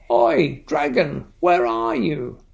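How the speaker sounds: a playful storybook call to the dragon; shouting. Acted. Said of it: "they shouted all together".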